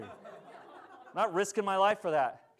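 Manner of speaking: mock retort through laughter; laughing. Preacher joking as firefighter refusing, laughing. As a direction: laugh while brushing it off. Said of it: "mock firefighter answer, laughing".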